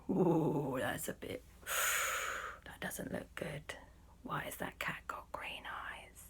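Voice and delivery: hushed, spooked murmur; uneasy whisper. Acted. Friends eyeing the green-eyed cat, uneasy whisper. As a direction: whisper it warily with a nervous sigh.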